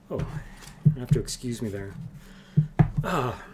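real, halting, moved to emotion; choked up. Real, not acted. Reader excusing himself after a sad scene, choked up. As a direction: pause and gather yourself, choked up. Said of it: "Chokes me up".

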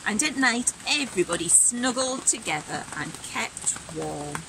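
put-on bright, animated read-aloud for kids; lively storybook reading. A narrator's reading. Teacher reading Robin's winter story aloud, animated and bright. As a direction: act the story out with energy.

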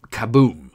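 loud playful kaboom; mock explosion. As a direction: burst out the boom like a cartoon blast. Mimics a distillery explosion.

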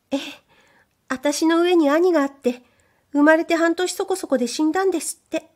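lightly voiced sweet maid; a touch of a murmuring maid. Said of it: "少ししたったるいささやき声で女中は言った".

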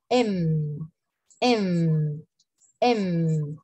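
letter M with a long hum, as if savoring; lips-closed M. Shown as a demonstration. Teacher models English M for students, lips-closed hum. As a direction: say "Em" with lips pressed, humming the m. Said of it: "es como cuando saboreas algo".

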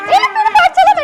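high, loud squealed plea; playful alarm. Pretend. Mother begs cat-costumed Elif to stop, high mock-panicked squeal.

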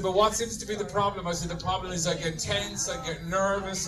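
normal talking voice

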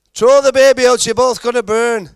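put-on high, loud shout in a shaky-at-best Irish accent; urgent high holler, failed Irish accent; bad Irish accent. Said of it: "can't do an Irish one either".

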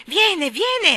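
high, loud cry of effort; excited gasping. A narrator's reading. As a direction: call out eagerly while straining. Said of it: "ansimò Michael". (ansimando, con voce acuta e concitata)

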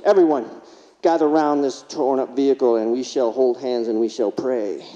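solemn minister calling to prayer, slowing down. Retold or imitated. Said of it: "Yes, minister".

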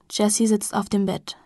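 ordinary delivery, nothing marked

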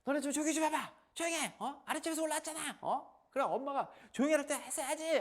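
put-on high, loud yelling at the kids; mother yelling from inside the flat. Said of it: "소리를 막 지릅니다".

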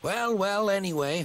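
high, indignant huff; huffy. A narrator's reading. Thomas huffing at Toby over paint colour. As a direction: brush off the point with a huff. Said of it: "huffed Thomas".

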